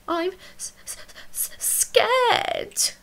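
high stuttering frightened whisper; scared whisper. A narrator's reading. The thing admitting fear to Emily Brown, stuttering whisper. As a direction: stammer the fear out in a breath. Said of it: "whispered the thing".

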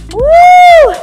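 loud high whoop; excited whoop. Real, not acted. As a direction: whoop to fire up the class. Instructor cheering mid-workout.

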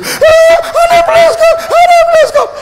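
very high, loud mock wail; fake pleading wife. Wife putting on drama for her husband, hammed-up high falsetto wail. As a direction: ham up a wife's false crying plea. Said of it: "They will act, they will do you drama".

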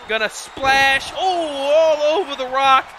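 high, urgent play-by-play; excited call. Real, not acted. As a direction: call the big move with rising excitement. Calling a splash and cover.

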